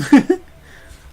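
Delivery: high loud laugh; a sudden burst of laughter. Real, not acted. Laughs after a slip.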